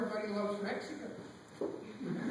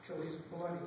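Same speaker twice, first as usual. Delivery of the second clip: ordinary delivery, nothing marked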